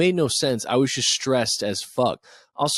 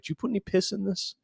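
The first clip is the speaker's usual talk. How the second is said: suspicious wife; 8 semitones higher, 5 dB quieter, faster than usual. Pretend. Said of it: "your wife every day ... being like".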